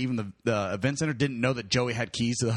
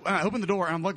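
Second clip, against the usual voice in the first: normal talking voice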